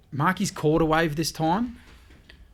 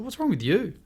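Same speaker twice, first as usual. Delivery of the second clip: retold incredulous question; 7 semitones higher than usual. Mate baffled by narrator freaking out.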